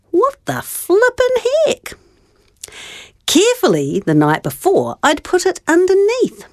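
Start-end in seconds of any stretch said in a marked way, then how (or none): 0.1-1.8 much higher, startled blurt; surprised exclaiming. Retold or imitated. Child finding no coin under pillow, startled high exclaim. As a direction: gasp it out at the empty pillow. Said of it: "I couldn't help but say out loud".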